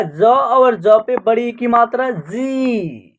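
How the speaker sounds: "जी" stretched on a falling tone; pronunciation demo. Shown as a demonstration. Teacher answering how it is pronounced, "जी" drawn out. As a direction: spell it out slowly, ending on a long "जी". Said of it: "तो इसका उच्चारण क्या होगा?".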